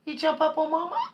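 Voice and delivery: sweet pet voice. Real, not acted. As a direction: coo softly. Cooing to cat.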